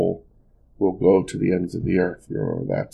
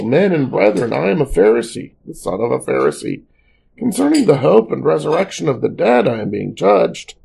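raised, higher reading of a public appeal; crying out; 7 semitones higher, 4 dB louder than usual. A narrator's reading. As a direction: mildly cry out to the council as a reader would. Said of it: "he cried out in the council".

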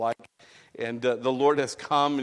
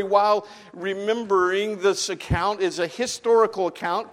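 louder insistent pulpit voice; emphatic sermon voice; earnest preaching; 7 semitones higher, 7 dB louder than usual. Acted. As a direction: drive the point home to the congregation.

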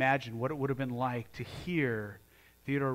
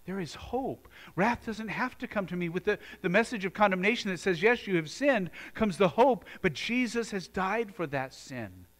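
high, loud preaching build; a swelling pulpit cry of hope; full-force preaching; 6 semitones higher, 4 dB louder than usual. Acted. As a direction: preach it out with rising fire.

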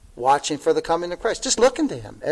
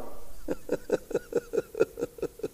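high breathy laugh; 10 dB quieter than usual. Real, not acted. Laugh after point.

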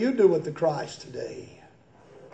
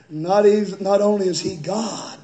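normal talking voice

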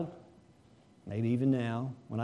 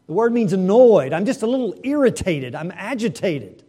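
mock annoyance; 5 semitones higher, 9 dB louder than usual. Preacher acting annoyed.

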